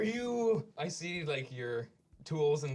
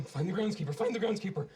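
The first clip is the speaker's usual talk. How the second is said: anxious thoughts aloud; 6 semitones higher, 5 dB louder than usual. Acted. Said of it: "And he's thinking".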